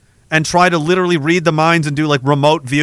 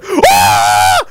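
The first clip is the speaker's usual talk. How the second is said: sudden yell; 10 semitones lower, 4 dB louder than usual. Retold or imitated. Guard attacking intruders.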